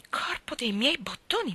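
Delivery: hushed, unvoiced "Corpo dei miei bottoni"; shocked whisper of the boy's oath. A narrator's reading. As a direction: gasp the oath under your breath. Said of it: "esclamò Tom".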